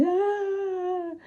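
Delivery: high loud cry of a contraction; pain cry. Retold or imitated. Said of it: "Eu tive uma que eu já fiz assim".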